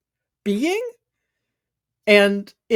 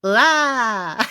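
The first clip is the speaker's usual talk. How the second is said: a bright toy chirp; Furby chirp; 2 semitones higher than usual. Acted. Furby in the pile squeaking at the robot, toy chirp. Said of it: "One of them goes".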